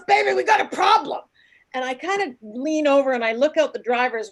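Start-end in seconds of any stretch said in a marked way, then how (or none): 0.1-1.2 high and loud, urgent warning; husband warning his wife, loud and worried; alarmed call. Retold or imitated. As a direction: announce the problem in a panic. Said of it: "He goes".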